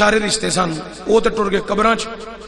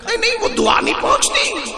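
much higher, mock-fussy cleric voice; 12 semitones higher than usual. Preacher mocks the cleric's objection to the congregation, high mock voice. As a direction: squeak out the cleric's protest in mockery.

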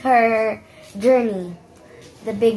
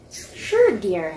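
grandmother voice; 2 semitones lower than usual. Acted. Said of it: "grandmother voice".

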